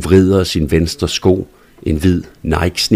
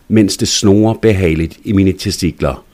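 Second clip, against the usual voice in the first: plain reading voice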